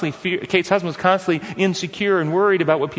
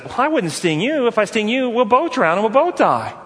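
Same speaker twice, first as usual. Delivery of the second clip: scorpion's promise; 7 semitones higher than usual. Acted. Scorpion voiced in fable.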